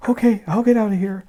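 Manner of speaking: fast, high, hurried agreement; brisk high okay; fast high character voice; quick high reply. Acted. Character answers in story.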